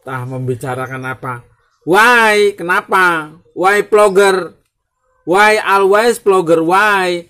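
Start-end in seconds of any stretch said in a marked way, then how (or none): 1.9-7.2 high and loud repeated question; hammed-up why. Vlogger loudly asking his viewers why to vlog. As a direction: bark the question at the crowd.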